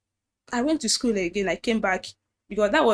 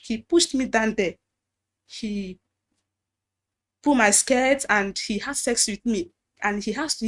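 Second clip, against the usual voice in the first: usual speaking voice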